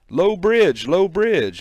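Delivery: loud, high warning call; warning shout. Acted. Canal hand shouting a warning so heads go down, urgent. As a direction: bellow the warning ahead.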